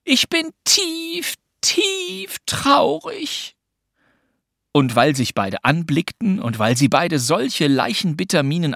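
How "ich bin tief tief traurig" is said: very high, thin-stretched wail of woe; shaken mock lament. Pünktchen tops the game, very high and mock-shattered. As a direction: drag it out sky-high, hammed-up devastated. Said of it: "murmelte sie erschüttert".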